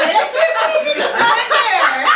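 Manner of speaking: shocked laughing line; laughing disbelief. Real, not acted. Shocked laugh, then repeated.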